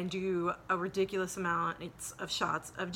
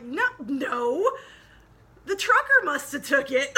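higher, louder mock-insistent denial; loud indignant protest; 6 semitones higher, 9 dB louder than usual. Arguing with the deputy, a loud drunk indignant denial. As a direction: blame the trucker with drunken conviction.

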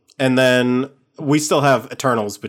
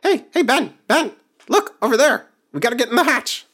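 much higher, excited little-creature voice; tiny eager rider; 14 semitones higher, 4 dB louder than usual. Acted. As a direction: urge your mount on like a small excited kid. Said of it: "tap the spider I'm riding and go".

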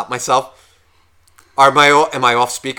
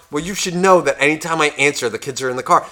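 ordinary talk

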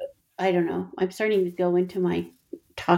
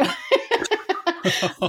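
bright laugh; 9 semitones higher than usual. Real, not acted. Laughing at the memory.

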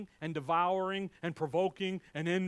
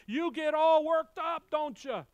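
loud, high-pitched agitated outburst; drawn-out, mock-agitated shout; worked up; 8 semitones higher, 9 dB louder than usual. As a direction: tease with a raised, riled-up voice. Acts out getting worked up.

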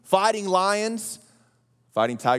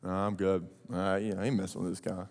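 soldiers backing off; 13 semitones lower, 10 dB quieter, faster than usual. Retold or imitated. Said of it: "his brothers and everybody else being like".

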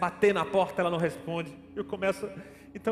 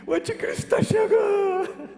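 lamento desesperado; 11 semitones higher, 4 dB louder, slower than usual. Retold or imitated. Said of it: "eu tava chorando".

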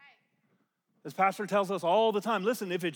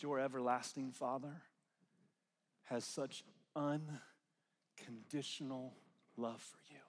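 much softer, near whisper; hushed tender preaching; 4 semitones lower, 9 dB quieter than usual. Real, not acted. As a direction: lean in and speak very softly. Intimate hushed ministry.

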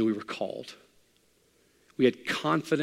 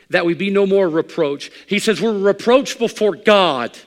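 loud, high, slow pulpit preaching; full-force preacher; fiery preaching; 8 semitones higher, 10 dB louder, slower than usual. Acted. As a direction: preach it out hard to the congregation.

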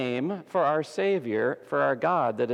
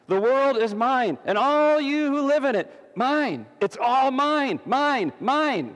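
much higher and louder, proclaiming God; booming God; 10 semitones higher, 4 dB louder than usual. Acted. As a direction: thunder it out as God owning all. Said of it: "God says".

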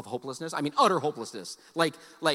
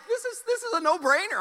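smug inner voice; 14 semitones higher, 5 dB louder than usual. Retold or imitated. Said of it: "I'm like".

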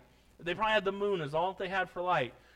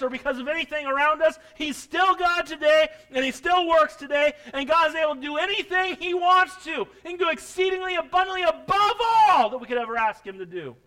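louder, higher preaching cadence; fiery Sunday preaching, louder and higher; building preacher's fervor; 7 semitones higher, 9 dB louder than usual. Real, not acted. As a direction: lift the church with rising force. Preaching at full force.

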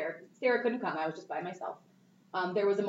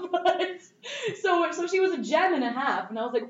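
ordinary delivery

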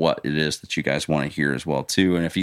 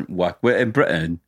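playful mock British accent. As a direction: put on a posh-ish British lilt. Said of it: "I get that you're doing the British accent".

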